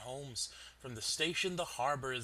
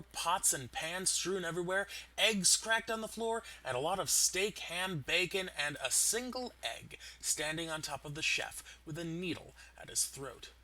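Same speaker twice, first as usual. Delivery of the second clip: usual speaking voice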